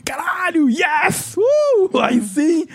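high, hyped-up fan yell; excited cheer. Retold or imitated. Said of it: "Se, se foi algo que foi".

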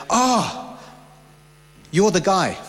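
high, bright jump on oh, then settling; surprised recognition. Retold or imitated. Strangers in Zambia recognizing him, surprised. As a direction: mimic a stranger suddenly recognizing someone.

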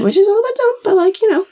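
high amused echo. Real, not acted. Echoing dumb remark.

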